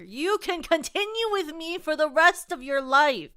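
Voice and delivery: high, loud, over-eager declaration; mock gushing. Player answering companion who may stay, loud gushing. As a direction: belt it out with hammed-up devotion. Jokey gushing reply to game character.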